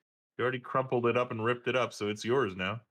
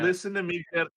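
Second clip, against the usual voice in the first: imitating daughter; 6 semitones higher than usual. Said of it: "She's telling".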